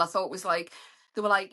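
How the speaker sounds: ordinary delivery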